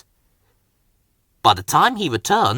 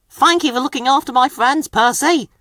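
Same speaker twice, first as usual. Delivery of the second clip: higher, fast thankful engine voice; grateful; 10 semitones higher than usual. A narrator's reading. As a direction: thank him warmly and quickly. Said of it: "puffed Thomas".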